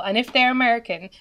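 ordinary delivery

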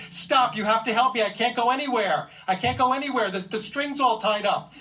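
frantic plea to the partner; panicked yell. Retold or imitated. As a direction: shout across the snow that you're stuck. Yelling at Brian for help.

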